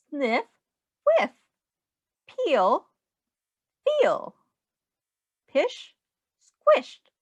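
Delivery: bouncy rhyming storybook voice, swinging up and down; sing-song read-aloud. Acted. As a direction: read it like a playful rhyme for a small child. Reading rhyming picture book aloud.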